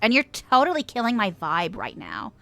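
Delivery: put-on whiny Valley Girl complaint; Valley Girl accent. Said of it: "my Valley Girl impression".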